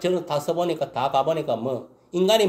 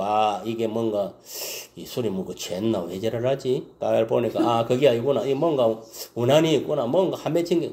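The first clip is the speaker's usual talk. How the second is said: musing priest; 6 semitones lower than usual. Retold or imitated. Priest musing on Hannah's cry.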